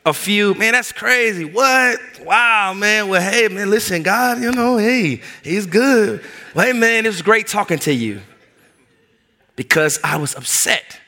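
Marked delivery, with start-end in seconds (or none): 0.5-8.2 much higher, rushed fake enthusiasm; brushing him off. Hurrying the church guy off the phone, higher fake enthusiasm. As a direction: pile on hollow "Man, that's crazy" higher, eager to hang up. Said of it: "So I hit him with a few".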